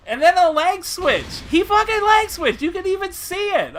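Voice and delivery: high, loud indignant protest; frustrated rant. Real, not acted. Player complaining about unseen leg switch, frustrated rant. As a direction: wail about the unfair move.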